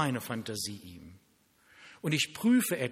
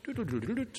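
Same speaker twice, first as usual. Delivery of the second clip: imitated phone tone; 9 semitones higher, faster than usual. Mimics phone dial tone.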